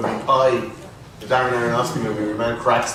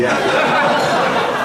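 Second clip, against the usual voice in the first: laughing; 5 dB louder than usual. Real, not acted. Agreeing while laughing.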